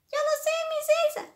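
very high, loud puppet-like voice; squeaky little pupil. Pretend. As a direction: pipe up from hiding in a tiny high voice. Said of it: "alguien dijo que lo sabe".

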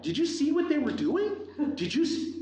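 high-pitched, agitated questioning; indignant outburst. Retold or imitated. Dad retelling his indignant outburst.

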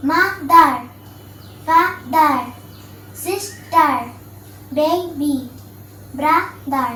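put-on mother, father, sister, baby, brother each split into two syllables with a gap. Shown as a demonstration. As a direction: break each word in two, as in "mo-ther". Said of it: "যতটুকু অংশ একবার উচ্চারণ করা যায়". (mother থেকে brother প্রতিটি শব্দ দুই সিলেবলে ভেঙে উচ্চারণ)

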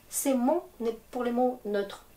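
usual speaking voice

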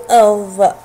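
loud "أض" ending on a voiced, heavy th; "أض" sounded out with tongue between the teeth; letter sound demo. Shown as a demonstration. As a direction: demonstrate the emphatic interdental ẓ in "أض". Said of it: "حرف الضاء وصوته".

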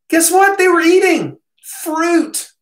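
high, loud triumphant guess what, then slow and drawn out; spring the answer like a gotcha; excited reveal. Real, not acted. Punchline of Eden fruit argument.

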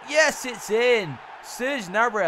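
loud, high, stretched-out celebration; excited goal shout. Real, not acted. Commentator celebrates as the corner goes in, excited shout.

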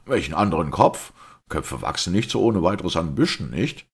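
loud, high-pitched bewildered protest; taken aback. A narrator's reading. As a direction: question it in baffled disbelief. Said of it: "meinte O'Brien betroffen".

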